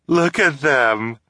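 high, slow cackling voice; mocking laugh. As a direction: jeer through laughter.

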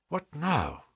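very soft, hushed question; whispered. A narrator's reading. Holmes privately asking his companions, whispered. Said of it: "whispered Holmes".

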